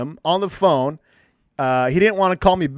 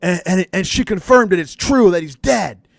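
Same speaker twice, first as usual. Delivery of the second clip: mock bawling; 2 semitones higher than usual. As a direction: retell it as a mildly hammed-up wail. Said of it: "like, bawling".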